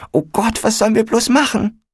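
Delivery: high, hurried cry of helplessness; panicked wail. A narrator's reading. Corinna to friends at the fire, high panicked wail. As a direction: wail it high, rushing to the end.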